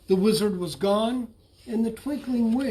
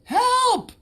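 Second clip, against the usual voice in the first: very high, loud desperate yell; shouting for help; 14 semitones higher, 6 dB louder than usual. A narrator's reading. Stranded bullfrog shouting for help, high desperate yell. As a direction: scream for rescue over the storm. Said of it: "he shouted".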